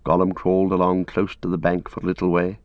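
ordinary talk

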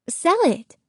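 put-on high, eager girl voice; eager young girl. A narrator's reading. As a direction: urge him like a hopeful girl. Isabel pleads.

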